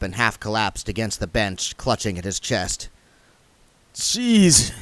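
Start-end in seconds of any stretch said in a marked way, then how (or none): 3.9-4.7 moaning. Acted. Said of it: "he moaned".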